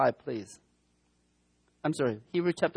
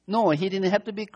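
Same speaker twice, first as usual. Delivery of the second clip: ordinary talk, nothing marked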